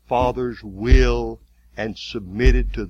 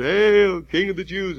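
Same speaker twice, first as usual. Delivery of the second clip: higher sneering hail; mock homage; 7 semitones higher than usual. A soldier bowing in mockery, higher sneer. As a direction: fake reverence with a sneer. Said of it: "he would bow before him and he would".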